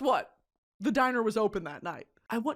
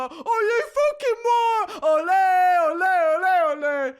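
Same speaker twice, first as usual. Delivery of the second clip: put-on high, loud drunken chant; drunk yelling; 8 semitones higher, 6 dB louder, slower than usual. Yelling at the bartender drunk, loud chanting. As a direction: yell it like a rowdy football fan. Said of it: "I'm yelling at the bartender".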